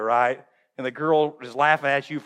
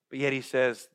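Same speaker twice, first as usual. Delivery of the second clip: usual speaking voice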